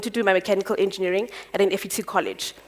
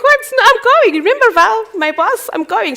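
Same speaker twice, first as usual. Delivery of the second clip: much higher and louder, pleading disbelief; indignant protest; 12 semitones higher, 7 dB louder than usual. Retold or imitated. Daughter protesting to her mother who forbids the move, high and loud. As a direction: push back hard, voice jumping up.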